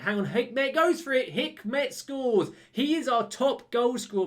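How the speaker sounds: real surprise and delight at the goal; excited goal call. Real, not acted. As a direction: build up and cheer the goal. Sudden goal during live play.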